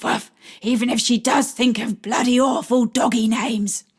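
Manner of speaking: playful put-on dog voice with barks; woman voicing a china dog for a shy child; doggy voice. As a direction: play a friendly dog to coax a shy boy. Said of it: "doggy voice".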